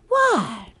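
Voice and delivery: high falling question to an animal. Real, not acted. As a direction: call to a pet, high then falling. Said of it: "That's my animal voice".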